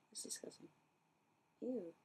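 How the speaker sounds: hushed, grossed-out aside; disgusted whisper. Real, not acted. Looking at dirty boot soles.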